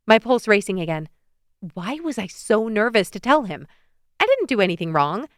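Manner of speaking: ordinary delivery, nothing marked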